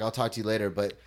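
usual speaking voice

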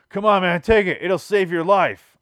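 loud, high-pitched pleading push; insistent urging. Retold or imitated. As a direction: call out to talk him into it. Stranger urging him to take cigarette.

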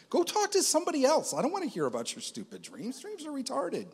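high, whiny, exasperated mock voice; annoyed brush-off. Retelling how he brushed off people's dream talk, whiny high annoyance. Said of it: "And I was so annoyed".

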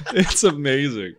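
usual speaking voice

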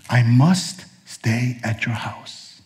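slow, soft, each word weighed, must leaned on; slow and weighty. Shown as a demonstration. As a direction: let "must" sink in slowly. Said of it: "But instead he says this".

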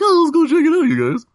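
high, tiny critter voice; squeaky critter voice. Acted. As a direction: pipe up like a small animal.

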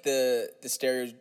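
ordinary delivery

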